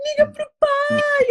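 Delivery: high, drawn-out mock wail; slow, hammed-up tearful plea; crying. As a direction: imitate a sister wailing at the wheel. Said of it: "a minha irmã dirigindo começou a chorar".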